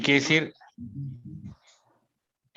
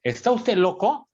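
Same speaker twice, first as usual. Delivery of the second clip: slower, higher-pitched mock disbelief; incredulous retort; 7 semitones higher, 4 dB louder than usual. Preacher answering a brother's invitation to be pastor, acted incredulous retort. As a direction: imitate a preacher taken aback, drawing out the question. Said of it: "Dice Paul Washer".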